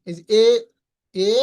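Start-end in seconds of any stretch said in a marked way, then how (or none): none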